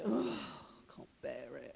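heavy exasperated sigh, words low and slow; mock groan of someone at the end of their patience; frustrated sighing. Preacher imitating himself stuck in traffic, frustrated sigh. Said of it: "when I'm really agitated and frustrated".

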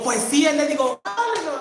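high, loud outburst; retold excited recognition. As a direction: let the pitch leap as you recognise someone. (exclamación aguda y emocionada)

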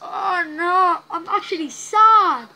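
choking up, really sad; real crying. Real, not acted. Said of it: "As you can tell by my voice".

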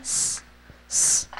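hissed "死" with no voice, whispered; soft unvoiced s, drawn out; voiceless s sound. Shown as a demonstration. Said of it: "所以这边的死发这个音". (清音 s，气声发出)

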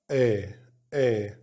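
short A said آ, open and brief; short a sound. Shown as a demonstration. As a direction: demo the short "آ" vowel clearly. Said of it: "بننطق ال A". (نطق حرف A القصير آ)

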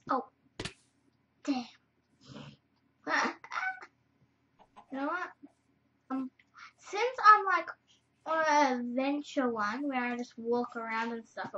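normal talking voice

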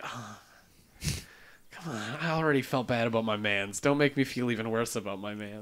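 soft, low, deflated complaint; pained groaning plea. Acted. Fan pleading on behalf of his favorite character, deflated. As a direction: sigh it out quietly, mock heartbroken.

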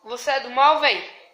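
loud frightened pleading; scared shouting. Real, not acted. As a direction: shout at him to stop. Pleading with prankster to stop.

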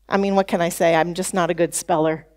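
low-pitched, resigned shrug of a voice; acted self-deprecation, dropping low; resigned self-talk. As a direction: excuse yourself in a low, defeated tone. Voicing the defeated self-message.

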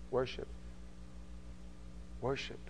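quiet reverent answer; hushed emphasis. Acted. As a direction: say it almost in a whisper. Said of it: "quiet emphatic repetition".

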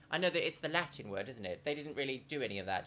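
normal talking voice

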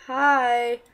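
normal talking voice

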